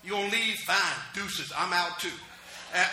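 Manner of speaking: ordinary talk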